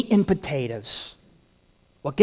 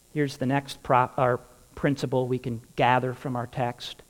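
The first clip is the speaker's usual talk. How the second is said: normal talking voice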